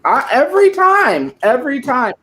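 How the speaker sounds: excited. Real, not acted. Gleeful admission to friends.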